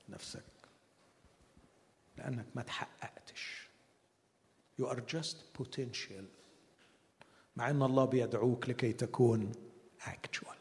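soft, slow, half-whispered; hushed. Real, not acted. Preacher confiding to congregation, soft and slow. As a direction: lean in and murmur it.

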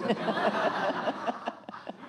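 high, breathy burst of laughter; real laugh. Real, not acted. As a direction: laugh out loud at the memory. Laughing at the smoking story.

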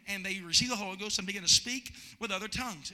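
ordinary talk, nothing marked

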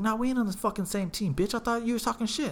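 high, heated retort; retold indignant confrontation. Friend refuses the guy's peace offer, indignant.

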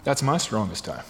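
suddenly low and soft; dry aside. Real, not acted. Preacher admitting to the congregation his coffee dependence, low dry aside. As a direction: drop it as an offhand confession.

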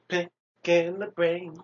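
drawn-out silly chant; slow goofy voice. Pretend. Playful repeated catchphrase chant.

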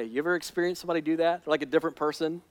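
ordinary talk, nothing marked

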